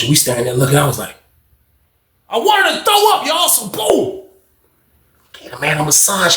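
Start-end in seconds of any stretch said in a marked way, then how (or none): 2.3-4.2 very high, squealed aside; exaggerated disgust. Real, not acted. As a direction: squeal it in comic revulsion.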